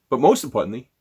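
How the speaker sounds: usual speaking voice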